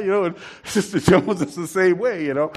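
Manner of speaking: usual speaking voice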